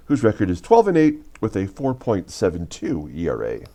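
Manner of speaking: steady broadcast intro voice; announcer read. Acted. Reads the game intro.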